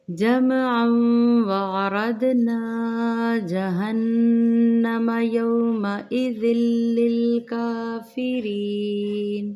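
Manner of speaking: slow, melodic tajweed chant; Quran recitation. Acted. Teacher opens the lesson with a slow chanted recitation. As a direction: recite it slowly and melodically, like in the mosque.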